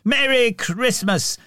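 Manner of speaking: high joyful farewell call; called back. A narrator's reading. Scrooge leaving calls back to the Cratchits, high and slow. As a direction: shout it back through the door. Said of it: "he called back into the house".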